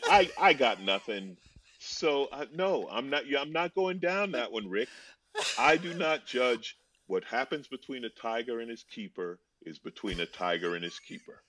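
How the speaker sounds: ordinary delivery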